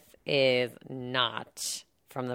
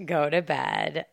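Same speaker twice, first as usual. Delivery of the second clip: ordinary talk, nothing marked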